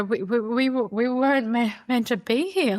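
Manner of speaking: stammering, tearful disbelief; words catching as tears come; telling the agent, close to tears; choked up. Retold or imitated. Overwhelmed, tears coming at house.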